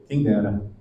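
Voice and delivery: low, resigned sigh of "Quem dera"; skeptical "if only". Retold or imitated. Preacher voicing a brother who doubts his miracle, resigned sigh. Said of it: "é como se ele dissesse o quê?".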